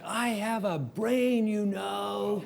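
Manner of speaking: slow, indignant retold yell; retold shout. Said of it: "I just shouted it".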